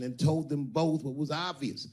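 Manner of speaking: ordinary talk, nothing marked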